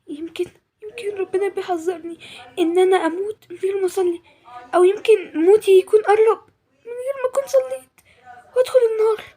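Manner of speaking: high, halting fearful voice; tearful dread. Acted. Girl fears dying without praying, tearful and afraid. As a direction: climb in pitch as the dread takes hold. Girl shaken after warning dream.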